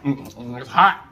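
chewing hot food; breathing out around hot bite; mouth full. Real, not acted. Seen 0: Puts cornbread in mouth at -2s; mouth working, hand fanning heat.

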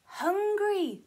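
Hungry said high and bright. Shown as a demonstration. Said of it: "my caterpillar friend is".

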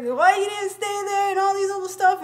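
high, loud imitated shout; mom shouting. Mom shouting at her daughter from the passenger seat, high mock yell. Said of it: "She's like".

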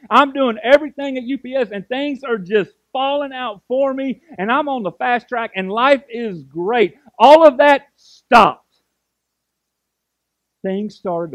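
loud, driving preacher voice, pushing each line; a pastor preaching at full force to his church. Acted. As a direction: build it like a revival sermon, loud and fervent.

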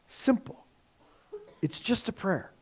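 very soft, almost whispered; barely voiced, gentle understatement; hushed simplicity. Real, not acted. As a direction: drop to a hush for the simple point.

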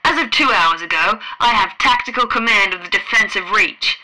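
loud, furious dressing-down; angry admiral. A narrator's reading. The Admiral berates Fred and demands he obey, loud and angry. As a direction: hammer the order home.

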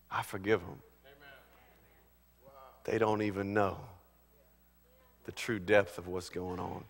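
very soft and low; hushed forgiveness. Acted. Preacher voices Jesus forgiving those who hurt him, hushed. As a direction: drop right down to a tender, quiet murmur.

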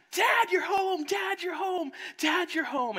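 put-on high, loud children's cry; excited kids. Kids running to greet their dad, high excited shout. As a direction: squeal it like happy small kids.

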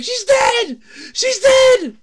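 re-enacted high, loud hollering; retold gleeful scream. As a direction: scream the news like you're reliving it. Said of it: "screaming, hooting, hollering".

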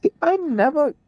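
usual speaking voice

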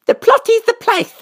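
put-on very high small-child voice, lightly lisped; squeaky, proud child character; proud little princess. A narrator's reading. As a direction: proudly parrot it like a tiny princess. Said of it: "said the little princess proudly".

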